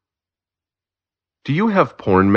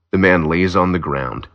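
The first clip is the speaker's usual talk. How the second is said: ordinary delivery, nothing marked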